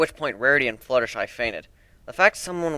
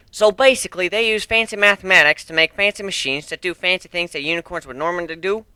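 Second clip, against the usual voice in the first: Applejack voice; 6 semitones higher, 5 dB louder than usual. Acted. Applejack summing up the machines to friends, loud country voice. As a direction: sum it up plainly like a farm pony.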